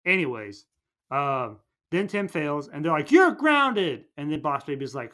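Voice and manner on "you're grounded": high, loud parental outburst; hammed-up angry parent; mock scolding yell. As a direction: hand down punishment in a pitched-up shout. Parents punish Tim in retold story.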